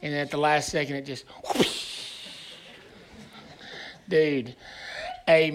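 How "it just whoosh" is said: breathy whoosh, slowed down; whooshing towel noise. Retold or imitated. Counselor acting out the towel snap, breathy whoosh. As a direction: imitate the wet towel slicing the air. Towel snapping through the air.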